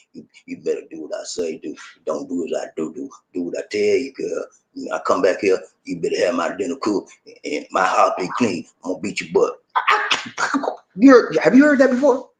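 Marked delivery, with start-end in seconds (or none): 0.1-9.6 put-on domineering good old boy. Mocking a controlling husband's boasts to his woman, in a low mock tough voice. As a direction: play the bossy husband laying down the law, way down low. Imitating the same abusive guy.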